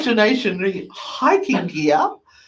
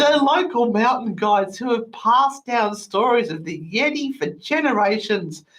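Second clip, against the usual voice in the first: ordinary delivery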